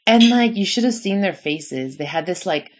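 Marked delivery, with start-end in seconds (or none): none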